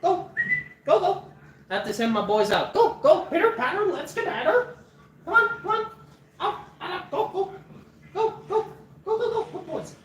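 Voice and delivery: high coaxing dog-calling voice; sing-song call to the dogs. Real, not acted. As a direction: chirp at the dogs to get them out.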